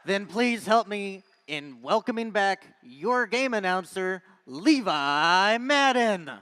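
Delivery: hyped arena announcer voice; fighting game announcer. Acted. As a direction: pump up the crowd like a match host. Hypes teams in the arena.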